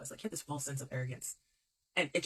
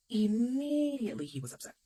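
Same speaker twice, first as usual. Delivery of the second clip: dramatic emphasis; 8 semitones higher, 6 dB louder than usual. Real, not acted. Dramatic emphasis on his reaction.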